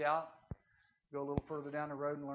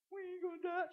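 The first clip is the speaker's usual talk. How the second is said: stage-fright panic; 13 semitones higher, 4 dB quieter than usual. Acted. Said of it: "Some of 'em are in total control. Others are going".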